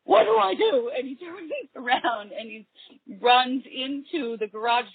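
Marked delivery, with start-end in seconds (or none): 0.1-0.9 mock panicked kid, pitched much higher. Retelling the seltzer kid's panic to her host, mock-panicked. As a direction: squeak it out like a kid in trouble.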